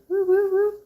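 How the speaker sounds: high little woo-woo cheer. Real, not acted. Cheering herself on while working one-handed. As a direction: hoot for yourself.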